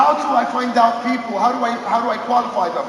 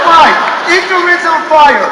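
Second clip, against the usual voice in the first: loud and slow, determined; a fired-up, slow, loud promise; fired-up vow; 5 semitones higher, 9 dB louder, slower than usual. Retold or imitated. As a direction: declare it like a battle cry. His fired-up answer to mentors.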